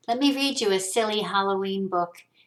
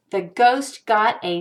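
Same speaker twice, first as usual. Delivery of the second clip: usual speaking voice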